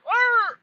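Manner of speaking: high, loud mock snarl of a dog; playful high dog growl; imitated dog bark. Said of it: "Dog goes".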